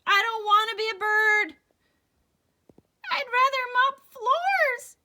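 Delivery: high, loud desperate wail; honked. Acted. Eddie refusing to become a bird, desperate wail. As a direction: cry it out in panic. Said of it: "he honked".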